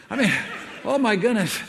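breaking into a laugh mid-phrase; chuckling at himself, still a bit high and loud. Real, not acted. Speaker to audience, laughing at his own forgetfulness.

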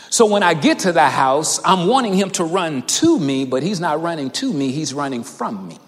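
ordinary talk, nothing marked

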